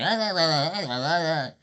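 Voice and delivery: mock yelling. Streamer mimicking the AI screaming at him, mock yelling. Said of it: "the way you like".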